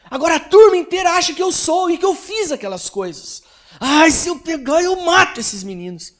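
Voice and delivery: voz de menino bem mais aguda e mais alta, chegando a gritar; menino furioso. Retold or imitated. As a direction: imitando um garoto humilhado que explode de raiva. Menino humilhado desabafa com raiva. (filho humilhado na escola desabafa com o pai, gritando agudo)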